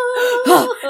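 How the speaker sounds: high, amazed gasp; mock awed gasp. Tim Allen and kid hearing elf music, high gasp.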